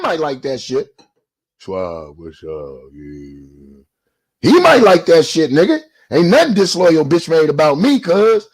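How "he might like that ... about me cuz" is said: louder, hard defiant push; loud retort. Real, not acted. Rejecting the disloyal label, loud and defiant. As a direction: throw the words back loud. Heated callout of a rival.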